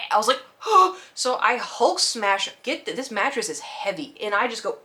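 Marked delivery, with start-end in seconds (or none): 0.4-1.1 sudden loud high gasp of alarm; sharp gasp. Retold or imitated. Owner finding her cat trapped under the bed, gasping in alarm.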